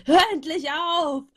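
loud, slow, high yell; imagined shout. Retold or imitated. Narrator imagining yelling at the boy, loud shout. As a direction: shake him and scream it out. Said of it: "ihn anschreien".

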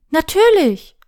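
high, loud, eager assent; eager promise. A narrator's reading. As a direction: promise it brightly and emphatically. Happy promise to her teacher.

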